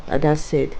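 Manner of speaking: plain reading voice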